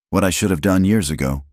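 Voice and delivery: ordinary delivery, nothing marked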